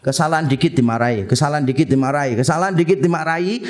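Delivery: mock rapid-fire nagging; rapid repetition. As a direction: rattle off like endless scolding. Mimicking constant scolding, repeated.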